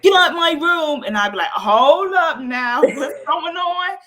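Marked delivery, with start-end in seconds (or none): 0.0-1.0 high, loud mock teenage outburst; imitated loud, high-pitched bossy yell; angry kid's yell. Daughter throwing mom out, retold. 1.5-4.0 slow, higher-pitched indignant parent; acted-out parental telling-off; mock scolding mother. Mother scolding back, retold.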